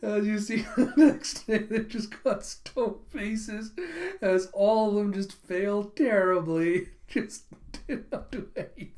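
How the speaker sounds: high, slow, laughing through the words; cracking up. Real, not acted. Storyteller recounts everyone failing terribly, cracking up. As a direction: struggle to talk through real laughter.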